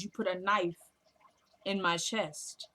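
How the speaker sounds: "You put a knife", long pause, then "in my chest" drawn out; slow pointed accusation. Retold or imitated. Mother repeating the stabbing to her son, slow and pointed "in my chest". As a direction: spell out the accusation slowly, pausing after "knife".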